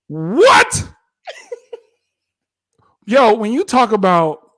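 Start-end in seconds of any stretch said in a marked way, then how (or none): 0.1-0.9 one stunned, punchy word; shocked disbelief. Retold or imitated. Bride hearing her husband is not attracted, shocked. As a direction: react as the rejected bride.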